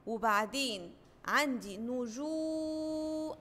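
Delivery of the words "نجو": slow "نجو", ending in a long level oo; long waw vowel. Shown as a demonstration. Teacher shows the madd with waw, "نجو" greatly prolonged. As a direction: stretch "جو" out on one held note. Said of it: "عندي مد بالواو".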